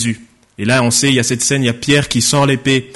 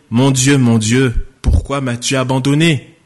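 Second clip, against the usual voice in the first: ordinary delivery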